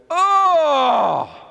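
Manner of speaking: high, loud shocked "Oh!"; family's startled cry of disgust; shocked family gasp. Retold or imitated. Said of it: "They went".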